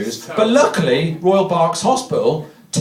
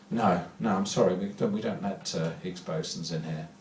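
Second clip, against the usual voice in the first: much lower, much softer priestly refusal; stern priest; 10 semitones lower, 13 dB quieter than usual. Retold or imitated. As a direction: turn him away gently but firmly from the pulpit. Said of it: "the priest says".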